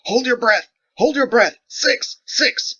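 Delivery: very high, loud urgent shouting; frantic shouted orders. Acted. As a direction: yell the warning as she runs.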